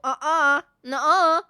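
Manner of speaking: put-on loud, childish back-and-forth contradiction; mock schoolboy bickering; boys arguing. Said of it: "Isn't that how boys argue? They just go".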